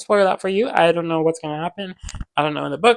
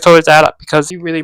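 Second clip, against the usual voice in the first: ordinary delivery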